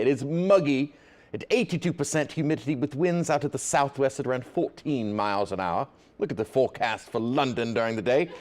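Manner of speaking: mock English weather forecast; put-on English accent with dropped r's. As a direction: read the forecast like a British presenter. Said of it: "with an English accent".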